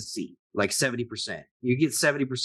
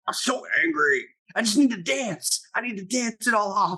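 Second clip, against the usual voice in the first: hammed-up teen angst, pitched up; mock angry teenager; 8 semitones higher than usual. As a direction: play an overwrought teenager for laughs. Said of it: "angry teenager-ish".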